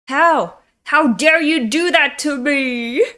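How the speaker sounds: high, wounded, acted indignation; mock tearful outburst. Defeated rival crying at the one who beat him, hammed-up outburst. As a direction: wail it as if beaten and crying. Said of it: "And he's crying".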